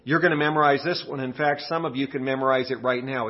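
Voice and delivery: ordinary delivery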